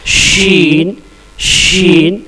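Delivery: शीन with a whistling sh; letter demo. Shown as a demonstration. Teacher showing students the letter, whistling sh. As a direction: round the tongue up and hush out शीन. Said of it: "सीटी की आवाज़ होगी".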